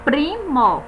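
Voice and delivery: usual speaking voice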